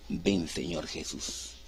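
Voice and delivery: very soft, low and slow invocation; bride's hushed plea. Acted. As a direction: draw it out as a hushed prayer. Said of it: "la novia y la amada que dicen".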